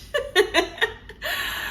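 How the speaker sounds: high-pitched giggle; high laugh. Real, not acted. Mocking her own crying to viewers, high giggle. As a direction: laugh at yourself.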